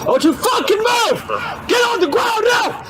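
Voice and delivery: shouted surrender demands. Real, not acted. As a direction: roar it at gunpoint. Officer holding suspect at gunpoint.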